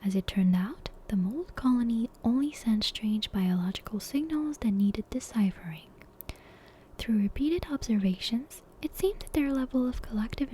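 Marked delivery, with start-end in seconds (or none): none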